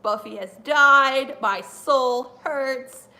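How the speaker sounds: loud, very slow tragic lament; mock grief. On her birthday after Buffy dies, hammed-up loud grief. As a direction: ham up the heartbreak. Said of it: "I'm just like".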